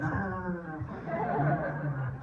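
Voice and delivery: high, soft string of protests; imitated pleading little girl. Daughter to dad who is leaving the cuddle, playful pleading.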